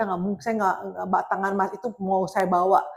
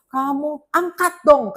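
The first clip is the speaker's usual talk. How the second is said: slow, higher mock plea; pleading suitor; 6 semitones higher, 4 dB louder, slower than usual. Scammer begging her to pick up, mock pleading. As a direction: plead slowly, imitating a needy suitor. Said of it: "Tuh, tuh, sama, sama ngomong".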